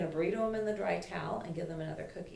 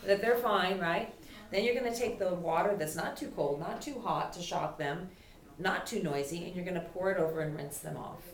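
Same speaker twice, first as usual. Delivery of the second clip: ordinary delivery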